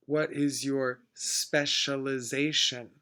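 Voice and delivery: ordinary talk, nothing marked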